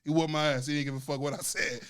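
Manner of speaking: usual speaking voice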